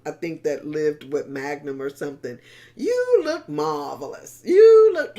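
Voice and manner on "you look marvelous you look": high, loud hammed-up rehearsal of You look marvelous; high, loud, showy practice take of You look marvelous; rehearsed compliment. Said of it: "he kept practicing how to say".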